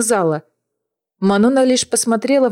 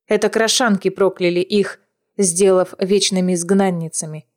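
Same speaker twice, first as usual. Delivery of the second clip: plain reading voice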